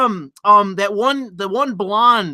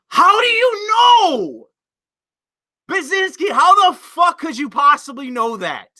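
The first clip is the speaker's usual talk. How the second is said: incredulous outburst; 8 semitones higher than usual. Real, not acted. Streamer yelling at a Brazilian viewer in disbelief. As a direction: shout your disbelief at the viewer.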